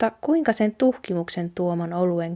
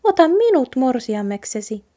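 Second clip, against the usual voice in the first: higher, perky offer from the mouse; brisk mouse voice; 6 semitones higher than usual. A narrator's reading. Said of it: "hiiri sanoi reippaasti".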